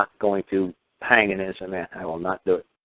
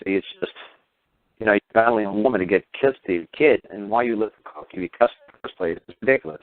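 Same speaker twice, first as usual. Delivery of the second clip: normal talking voice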